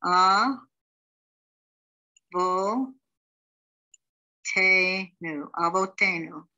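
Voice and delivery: A, vo, te, nu said apart, then avotenu joined; syllables then whole word. Shown as a demonstration. Teacher sounding out syllables, then blending the word. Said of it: "The third word".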